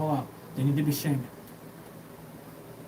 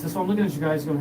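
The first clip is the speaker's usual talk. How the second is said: ordinary talk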